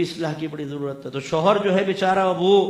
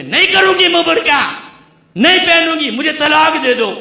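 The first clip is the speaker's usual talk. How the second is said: defiant wife; 10 semitones higher, 9 dB louder than usual. Retold or imitated. Rebellious daughters voiced by preacher.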